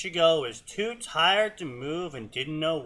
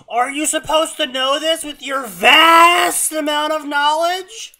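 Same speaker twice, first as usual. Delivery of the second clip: high, loud exasperated shriek; screaming; 13 semitones higher, 14 dB louder than usual. Real, not acted. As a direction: yell it in disbelief, voice cracking high. Mocking the story's dialogue.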